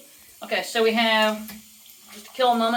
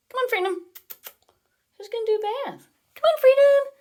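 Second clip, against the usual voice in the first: very high pet-talk coaxing; 12 semitones higher, 4 dB louder than usual. Real, not acted. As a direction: lure the bird with a high sing-song. Coaxing parrot onto hand.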